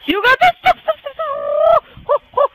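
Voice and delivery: high, urgent cries; real panicked gamer shouting; frantic yelling. Real, not acted. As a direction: yell high at the game. Frantic during live play.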